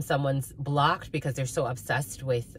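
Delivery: usual speaking voice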